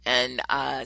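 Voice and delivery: ordinary delivery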